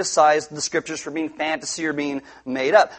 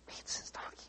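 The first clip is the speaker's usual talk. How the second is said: whispered; 25 dB quieter than usual. Acted. Whispered repeat after loud take.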